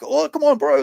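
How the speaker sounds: high, loud mock coaxing "come on, bro"; coaxing friend. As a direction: talk him round, voice jumping up high. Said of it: "And he's like".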